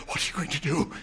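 ordinary delivery

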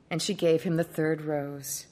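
plain reading voice

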